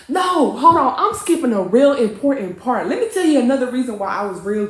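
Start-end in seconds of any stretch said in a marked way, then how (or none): 0.1-0.9 loud, high cut-in; sudden self-interruption. Real, not acted. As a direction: break off and halt the story loudly. Interrupts own story, forgot a part.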